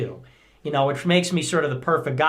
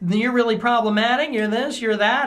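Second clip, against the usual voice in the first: mocking critics in a higher voice; needling mockery; 8 semitones higher than usual. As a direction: needle him like a snide critic. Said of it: "it's always this needling".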